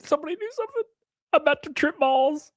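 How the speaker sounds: high, thin frantic squeak; mock panic. Streamer squealing in mock panic to chat. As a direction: pretend to panic over a nose hair.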